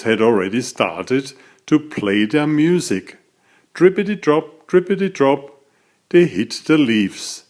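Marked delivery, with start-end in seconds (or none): none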